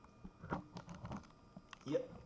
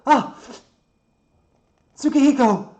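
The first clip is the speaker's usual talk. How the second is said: high, very loud panicked cry; alarmed scream; 18 dB louder than usual. Acted. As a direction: scream his name in horror. Builder falls, character screams name.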